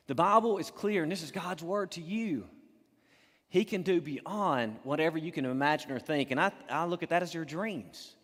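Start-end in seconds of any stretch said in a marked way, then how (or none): none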